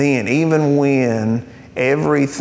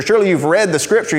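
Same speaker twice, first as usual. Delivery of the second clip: mock questioner; 6 semitones higher, 3 dB louder, much faster than usual. Heaven questioner voiced.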